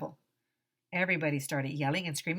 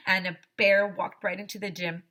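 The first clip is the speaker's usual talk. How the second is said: ordinary delivery, nothing marked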